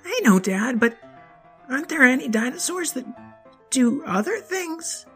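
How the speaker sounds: put-on curious little boy, high; eager child asking; high kid voice.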